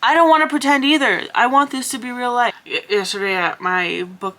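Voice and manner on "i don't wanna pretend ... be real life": louder, higher and faster insistence; excited retort. Retold or imitated. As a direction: push it out with eager conviction. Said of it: "I was like".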